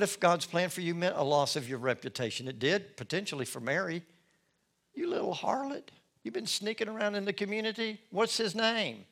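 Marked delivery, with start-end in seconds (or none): 4.9-9.1 higher, sneering accusation; a nosy neighbour scorning her; gossiping accuser. Acted. Townspeople accusing Mary, higher sneering voice.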